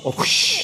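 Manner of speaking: breathy whoosh like a passing bus; whooshing bus noise. Pretend. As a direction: make a whoosh sound. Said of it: "pretend you act like a bus".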